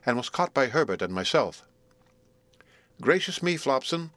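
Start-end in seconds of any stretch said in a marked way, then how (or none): 3.0-4.1 mildly surprised lady. A narrator's reading. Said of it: "said Missus Pocket".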